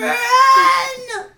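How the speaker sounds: high, loud screaming "Run!"; panicked shout. Acted.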